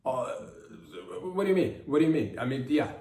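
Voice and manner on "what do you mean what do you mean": indignant. Acted. Mock indignant rhetorical question.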